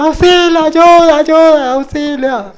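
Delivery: high, loud imitated screams for help; mock cry for help. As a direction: yell help as if in danger. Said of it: "siguió gritando".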